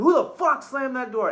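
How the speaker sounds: loud, high angry demand; retold scream. Retelling bursting in on the girl, angry yell. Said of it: "I scream".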